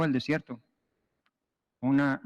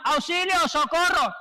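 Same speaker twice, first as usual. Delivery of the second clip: drowning man's plea; 12 semitones higher than usual. Retold or imitated. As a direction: beg for rescue from the water. Hombre ahogándose grita. (súplica aguda del hombre que se ahoga)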